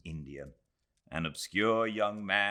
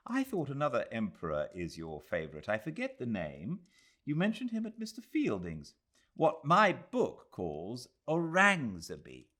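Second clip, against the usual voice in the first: put-on higher, quick young woman's voice; Miss Quested; 7 semitones higher, 4 dB louder than usual. A narrator's reading. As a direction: press the point briskly.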